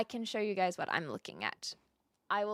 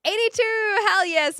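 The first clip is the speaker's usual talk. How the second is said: loud, high cheer for a viewer; excited cheer; 11 semitones higher, 9 dB louder than usual. Real, not acted. Streamer cheering a viewer's big number, loud and thrilled.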